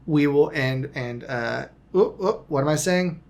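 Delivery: normal talking voice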